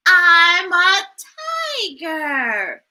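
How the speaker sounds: usual speaking voice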